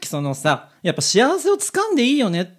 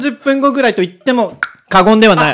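borrowed catchphrase; 5 semitones higher, 8 dB louder than usual. Retold or imitated. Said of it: "お借りしました".

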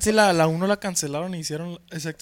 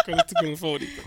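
higher, lightly put-on interviewer; mock interviewer; 8 semitones higher, faster than usual. Quoting Pedro the interviewer, higher pitch. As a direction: ask it like a dull interviewer. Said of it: "Pregunta Pedro".

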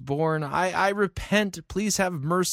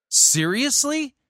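drawn-out, much higher, louder "seriously"; incredulous; 9 semitones higher, 4 dB louder, slower than usual. Real, not acted. Commentator to the preacher Perry, drawn-out incredulous disbelief. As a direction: stretch it out in disbelief.

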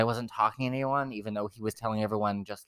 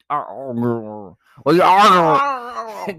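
loud, drawn-out garbled mock quarrel; hammed-up yelling, words smeared out; mock arguing; 6 semitones higher, 10 dB louder, much slower than usual. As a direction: blare it like a cartoon argument. Acts out a couple arguing.

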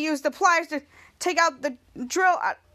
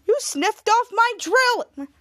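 higher, slow, drawn-out protest; outraged complaint; 6 semitones higher, 3 dB louder, much slower than usual. Retold or imitated. Villain protesting his broken drill, outraged. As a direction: act out an indignant villain.

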